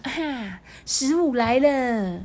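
high, drawn-out delighted realisation; hungry cat's eager thought; excited cat. Acted. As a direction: stretch it out as food appears. Cat's thought on spotting food.

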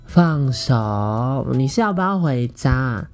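high, coy and teasing, drawn out; coquettish. Retold or imitated. Telling his friend to let go of his arm, coy and drawn out. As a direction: tease him sweetly, stretching the words. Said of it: "很三八".

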